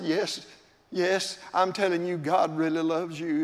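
put-on quiet reassuring words to a tearful girl; tender reply. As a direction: soften right down to reassure her.

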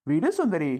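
ordinary talk, nothing marked

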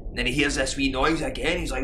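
ordinary talk, nothing marked

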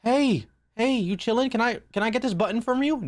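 high, careful put-on sweetness; placating friendliness. Asking a game monster nicely.

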